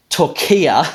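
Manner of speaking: Turkiya as "tor-KEE-uh", stress on KEE; anglicised Turkiya with a long stressed middle vowel; wrong English pronunciation. Shown as a demonstration. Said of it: "What went wrong in this English pronunciation?".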